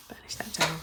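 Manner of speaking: whispered to herself; barely voiced murmur. Real, not acted. Whispered while working.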